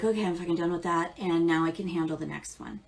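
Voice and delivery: fast, fed-up rush; impatient mock quote. Reader mimicking an eager viewer, rushed. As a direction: blurt it out to be done. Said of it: "and be like".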